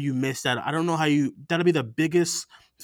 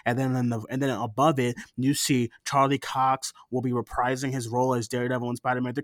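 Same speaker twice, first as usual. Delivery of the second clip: usual speaking voice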